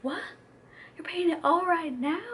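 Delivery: high, loud incredulous question; cute astonishment. Retold or imitated. Insurance woman reacting to paying it all, cute astonishment. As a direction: mimic her amazed disbelief. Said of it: "the woman was so cute. She was like".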